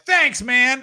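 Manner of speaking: loud, high mock gratitude; shouted thanks. Road comedians thanking their saviour, loud and eager. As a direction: call it out in hammed-up thanks. Comedians gratefully answering Musk.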